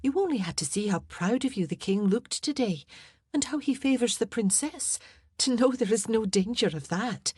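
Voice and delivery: high, earnest reassurance; reassuring Scots lady-in-waiting. Acted. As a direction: talk your mistress out of her fear.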